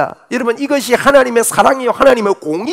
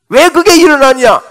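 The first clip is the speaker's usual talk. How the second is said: raised question; 6 semitones higher, 7 dB louder than usual. Real, not acted. Preacher raising voice.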